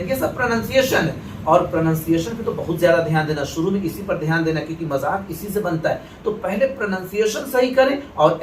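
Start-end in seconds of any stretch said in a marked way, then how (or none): none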